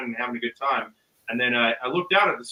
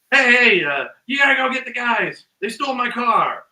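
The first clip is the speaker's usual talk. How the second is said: high, loud frantic phone plea; imitated screaming; 7 semitones higher, 6 dB louder than usual. Storyteller acts the robbed man yelling into a phone, frantic. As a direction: re-enact shouting into the phone. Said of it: "and he's screaming".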